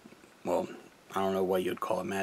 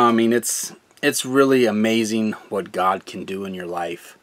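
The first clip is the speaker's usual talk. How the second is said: ordinary talk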